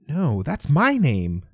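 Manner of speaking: put-on slow, high old-fashioned 1940s New York accent; 1940s movie accent. Leisha, sounding like someone else, to Lewis: slow 1940s movie accent. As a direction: talk like a movie extra from the forties. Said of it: "her accent is all wrong".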